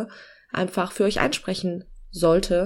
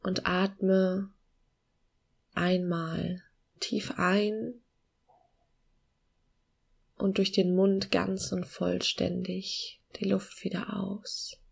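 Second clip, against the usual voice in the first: slow, soft meditation voice with long pauses; calm guiding voice, gently slowing down; guided meditation; 5 dB quieter than usual. Acted.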